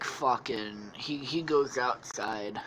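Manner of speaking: plain reading voice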